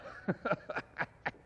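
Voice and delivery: a soft, unvoiced chuckle; breathy gleeful laugh. Scrooge laughing to himself over the turkey. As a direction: laugh to yourself in glee. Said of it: "splitting with a laugh".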